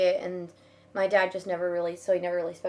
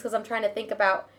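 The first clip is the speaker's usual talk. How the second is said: ordinary talk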